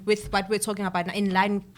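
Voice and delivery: ordinary delivery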